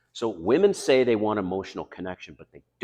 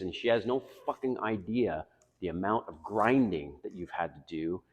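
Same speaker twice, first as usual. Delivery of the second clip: ordinary talk, nothing marked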